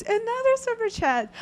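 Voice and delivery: high-pitched delighted exclamation; excited announcement. Real, not acted. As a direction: sing out the news with a big jump in pitch. Host announcing a viewer's donation.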